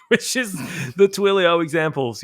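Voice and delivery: speaking through a laugh; chuckling while steering back on topic. Real, not acted. Laughing at his own digression.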